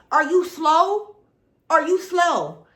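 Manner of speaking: loud, slow, drawn-out disbelief; real exasperation, loud and slow; exasperated. Real, not acted. Exasperated rant at the show.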